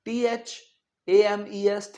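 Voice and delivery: टीएचएएमईएस drawn out for the class; टीएचएएमईएस spelt slowly by the teacher; slow spelling. Shown as a demonstration. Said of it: "आप सोचेंगे क्यों नहीं है?".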